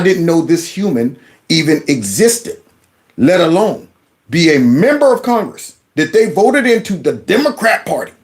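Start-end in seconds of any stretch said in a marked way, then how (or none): none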